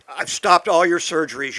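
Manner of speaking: loud, urgent retelling of a panicked doctor. Retold or imitated. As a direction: sound the alarm like the doctor did. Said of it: "called me in a panic".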